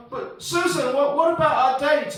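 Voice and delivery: high, loud protest; pleading call. Retold or imitated. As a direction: call after a date who is leaving. Retold plea to departing date.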